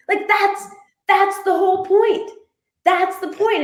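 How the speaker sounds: high, thrilled, getting it; a sudden light bulb, voice jumping up; excited realization. Retold or imitated.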